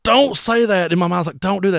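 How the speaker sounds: high, loud mock panic; alarmed plea. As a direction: beg him to stop, voice shooting up. Silently pleading with drummer to stop.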